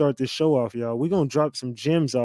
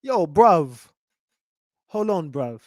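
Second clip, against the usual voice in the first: light mock London accent; Academics' London swag; slower than usual. Streamer mocking a clip's London swag, mock London accent. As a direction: imitate put-on London slang and accent. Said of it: "the whole London swag".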